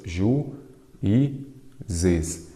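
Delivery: "Ju, i, zes" in three separate beats; syllable by syllable. Shown as a demonstration. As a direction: split juízes with a pause after each of Ju, i, zes. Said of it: "vamos separar em sílabas". ("Ju, i, zes" dito pausadamente, sílaba por sílaba)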